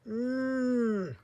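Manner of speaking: long, high squeezing hum; drawn-out affectionate mmm; hugging hum. A narrator's reading. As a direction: hum with effort through a big hug. Big hug sound.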